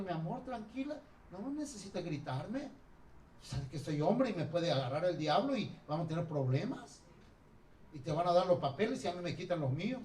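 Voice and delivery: soft, gentle calming plea; calm husband. Acted. A husband calmly asking his shouting wife to stop yelling, softly. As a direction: soothe her down softly.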